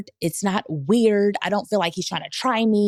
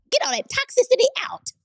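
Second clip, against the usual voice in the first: pitched way up, casting the demons out; hammed-up preacher driving out spirits; mock exorcism. Joking about spirits leaving her.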